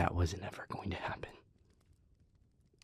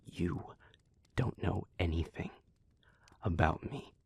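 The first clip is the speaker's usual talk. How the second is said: ordinary talk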